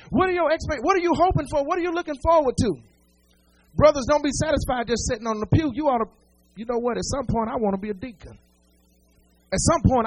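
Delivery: loud, rising preacher cadence, each line hammered; preaching at full force. Acted. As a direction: drive the congregation up with repeated calls.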